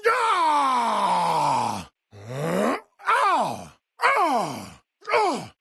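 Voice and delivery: high attack yells and grunts; combat cries. Acted. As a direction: yell with each strike.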